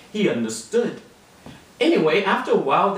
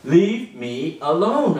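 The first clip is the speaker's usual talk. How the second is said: slow prompt; 3 dB louder, slower than usual. Shown as a demonstration. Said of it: "I said".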